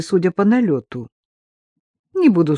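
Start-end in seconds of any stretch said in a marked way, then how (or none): none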